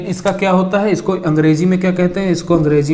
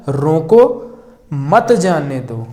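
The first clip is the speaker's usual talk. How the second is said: रोको set apart, then slower मत जाने दो; pause after रोको; much slower than usual. Shown as a demonstration. Teacher explaining the stop-him reading, pause after रोको. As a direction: break firmly after रोको. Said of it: "यहाँ पर क्या कहा गया है".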